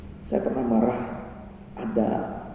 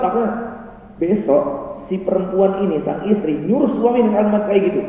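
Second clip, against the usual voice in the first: ordinary delivery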